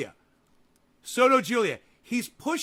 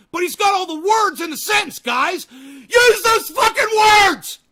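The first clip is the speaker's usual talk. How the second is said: exasperated shout; 5 semitones higher, 7 dB louder than usual. Real, not acted. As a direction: yell the plea at them. Begging players to use words.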